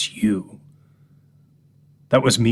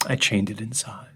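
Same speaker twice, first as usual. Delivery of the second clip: whisper; 3 semitones lower, 7 dB quieter than usual. Acted. Confession whispered.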